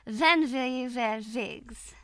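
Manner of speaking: put-on amateur German accent, W as V; careful second go at mock German. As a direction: say "will" and "wigs" with German V's. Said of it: "What about a German accent?".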